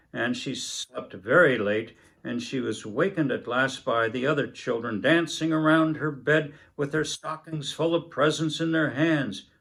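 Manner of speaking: ordinary delivery, nothing marked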